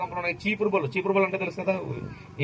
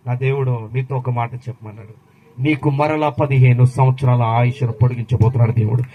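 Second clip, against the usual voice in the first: low voice swelling to a loud proclamation; weighty, low-pitched prophet's declaration; prophetic announcement; 6 semitones lower, 9 dB louder than usual. Acted. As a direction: deliver God's message, building in volume. Acts prophet delivering God's message.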